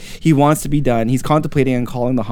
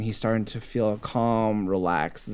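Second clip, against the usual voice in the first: slow, soft, lowered storytelling; calm narration; 4 semitones lower, 9 dB quieter, slower than usual. A narrator's reading. Narrator as he calms in nature, slow and soft. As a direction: ease down into a soothing hush.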